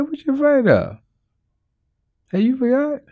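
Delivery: high, teasing rebuke. Retold or imitated. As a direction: tease them high and light. Jesus answering disciples.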